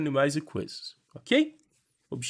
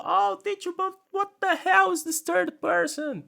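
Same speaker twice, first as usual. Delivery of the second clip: much higher, exasperated student whine; mock student; 3 dB louder, slower than usual. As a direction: play a fed-up student complaining.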